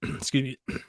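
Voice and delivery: softly clearing the throat mid-sentence; throat caught. Real, not acted. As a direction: excuse yourself through a real catch in the throat. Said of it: "I got something stuck in my throat".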